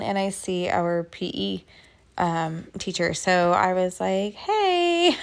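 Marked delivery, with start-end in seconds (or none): 4.3-5.2 high, sing-out mock-casual hey; retold hopeful greeting, pitched way up; coaxing greeting. Mom greeting PE teacher to ask a favor, coaxing. Sweet-talking the teacher into volunteering.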